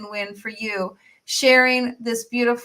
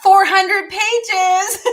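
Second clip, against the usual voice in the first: amazed; 9 semitones higher, 8 dB louder, much slower than usual. Real, not acted. Marvelling at the page count.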